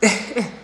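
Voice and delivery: short amused laugh; quick burst of a chuckle. Real, not acted. Laughs before self-correcting.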